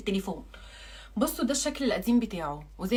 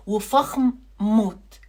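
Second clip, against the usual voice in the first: drawn out; 4 dB louder, much slower than usual. Acted. Luxurious praise drawn out.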